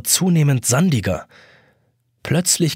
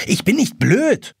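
higher, slower, snapping reply; curt retort; 9 semitones higher than usual. A narrator's reading. The boy snaps at the hourglass, offended. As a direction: bite back at the riddle. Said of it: "erwidert Jonas barsch".

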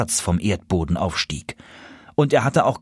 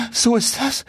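mild panting; 13 semitones higher than usual. Acted. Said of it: "keuchte der Gänserich".

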